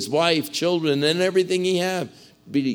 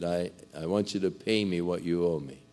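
quiet calm request; 8 semitones lower, 8 dB quieter than usual. Acted. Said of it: "and he said".

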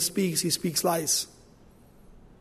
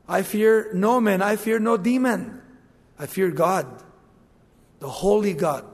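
normal talking voice

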